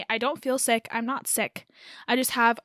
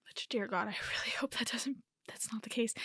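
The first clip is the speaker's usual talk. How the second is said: much softer, half-whispered aside; 10 dB quieter, faster than usual. Real, not acted. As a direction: drop to a whisper, as if praying under your breath. Muttered aside hoping against it.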